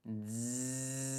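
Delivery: bee buzz. Shown as a demonstration. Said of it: "vibration sound".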